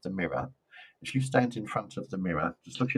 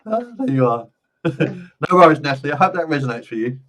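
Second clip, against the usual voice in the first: ordinary delivery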